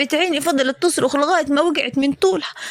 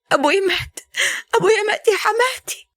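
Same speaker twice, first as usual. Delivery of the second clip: rising into sobs, higher on the last words; crying; 7 semitones higher than usual. Acted. Daughter telling her mother-in-law her father died, crying. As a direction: let the voice break into crying.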